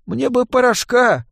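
high, slow pleading voice; stalling addict boy. A narrator's reading. Boy addict asking a dealer for powder, stalling. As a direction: wheedle it high and drawn-out. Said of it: "стараясь потянуть время".

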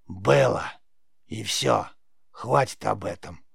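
raspy crone, curtly closing the subject; creaky old witch. A narrator's reading. Witch cuts off the boy's question, curt creaky rasp. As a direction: shut it down in a creaky voice. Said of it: "проскрипела ведьма".

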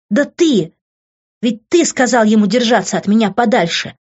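high, loud, driving tirade; furious accusation. A narrator's reading. Daughter's furious tirade at father. (яростная обвинительная тирада)

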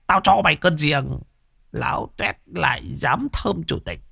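lightly voiced old man, cross and embarrassed; grumbling curses. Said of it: "lầu bầu chửi".

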